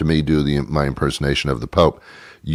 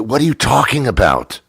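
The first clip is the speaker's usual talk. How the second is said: ordinary talk, nothing marked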